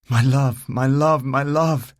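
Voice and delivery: loud, high, overjoyed; joyful reunion. Acted. Reuniting with a lost love in the garden, overjoyed calling. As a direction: cry out in relief and joy.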